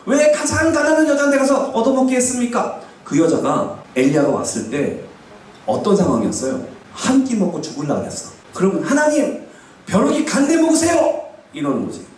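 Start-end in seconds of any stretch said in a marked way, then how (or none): 0.1-2.8 high-pitched mock complaint to God; acted protest. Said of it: "항의를 할 수 있어요". 8.8-11.2 high-pitched outburst at God; acted defiant protest. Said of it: "하나님한테 대들었어".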